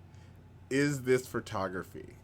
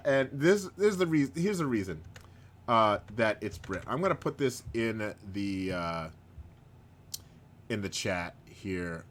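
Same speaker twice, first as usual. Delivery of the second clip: ordinary delivery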